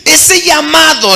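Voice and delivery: loud, high preaching at full force; preacher driving the call home, loud and high; fiery preaching. Acted. Preacher urging the congregation, loud and high, at full force.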